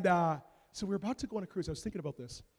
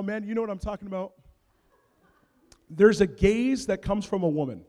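ordinary talk, nothing marked

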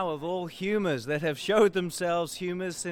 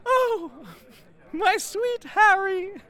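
high, fluttering mock wife voice; 13 semitones higher, 4 dB louder, slower than usual. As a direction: squeak it like a doting wife. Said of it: "says she".

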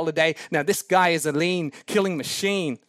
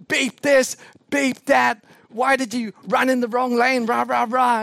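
raised, louder imitation of an angry cyclist; mock cussing; 4 semitones higher, 3 dB louder than usual. Preacher imitating the cyclist cussing him out, raised and loud. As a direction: rant back at him like the furious cyclist. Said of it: "all I heard was cussing".

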